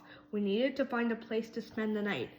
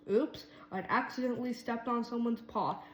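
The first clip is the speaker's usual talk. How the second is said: plain reading voice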